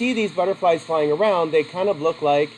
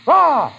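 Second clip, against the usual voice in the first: sudden high, loud "ah!"; imitated scare cry; 9 semitones higher, 7 dB louder than usual. Butterfly turns on the bird, startling shout. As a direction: jump out with a mock scare shout.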